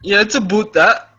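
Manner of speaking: high, loud mock Canadian "a boot". Mocking how Canadians say "about", high and loud. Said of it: "when they say a boot".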